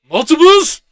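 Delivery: mock-panicked double take on "Multiples?"; shocked. Ruffalo shocked by clones.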